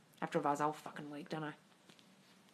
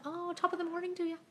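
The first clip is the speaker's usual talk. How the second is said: jokey high Irish lilt; mock Irish cheer; Irish accent; 12 semitones higher than usual. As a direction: toss off a stage Irish greeting, higher. Mock Irish greeting to viewer.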